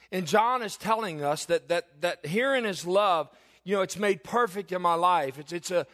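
preaching at full force. Acted. Pastor rousing his congregation about God's love, full-force preaching. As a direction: lift the congregation, drawing out each phrase.

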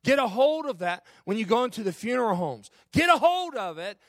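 building to a loud, high sermon climax; preacher's fervent rising exhortation; impassioned preaching. Real, not acted.

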